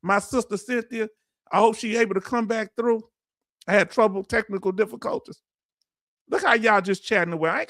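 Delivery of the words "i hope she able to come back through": usual speaking voice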